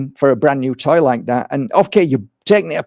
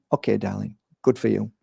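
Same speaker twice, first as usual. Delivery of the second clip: tender; 7 semitones lower, 9 dB quieter than usual. Retold or imitated. Father to little daughter.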